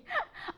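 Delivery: a quick high laugh; short laugh. Real, not acted. Speaker laughing at her own remark, short laugh. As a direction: breaks into a brief real laugh.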